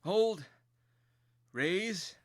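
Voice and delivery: loud, high barked orders; rally the frightened troops; commanding shout. Acted. Lieutenant shouting orders at toy soldiers, loud and high.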